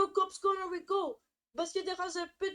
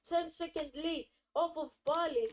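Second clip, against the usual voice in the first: usual speaking voice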